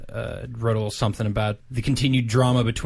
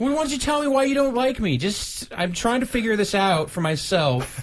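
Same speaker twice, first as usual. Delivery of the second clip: high, fast pleading voice; whiny Square voice; 8 semitones higher than usual. Acted. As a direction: play the hurt rejected partner. Voicing Square in skit.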